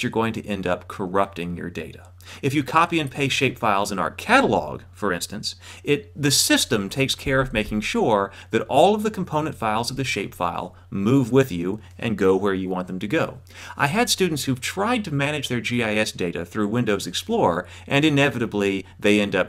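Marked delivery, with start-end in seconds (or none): none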